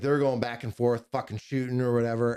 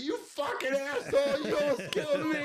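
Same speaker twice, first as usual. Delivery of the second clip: much higher, laughing as he quotes his buddy; laughing mock outrage. Buddy nearly hit by a mortar, laughing high mock outrage. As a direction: squeal the insult through laughter.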